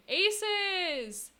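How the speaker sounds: high, loud, drawn-out name; exclaimed name call. Real, not acted. Streamer calling out a viewer who gifted a sub, exclaiming. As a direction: call the name out in playful protest.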